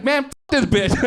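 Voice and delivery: high, laughing mock outrage; mock angry rant. Comic retelling a dating rejection, high laughing mock outrage. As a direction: squeal it out, fake furious. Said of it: "I was so mad".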